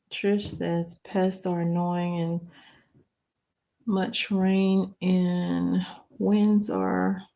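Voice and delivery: reading aloud slowly and haltingly; halting read of a hard-to-see comment; slow, struggling reading. Real, not acted. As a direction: struggle to make out each phrase as you read. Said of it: "if I'm reading slow like that".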